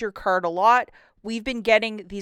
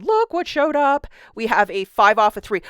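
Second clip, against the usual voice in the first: sing-songy voice; 7 dB louder than usual. Real, not acted. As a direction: sing it out like great news. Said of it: "When I'm breaking out the sing-songy voice".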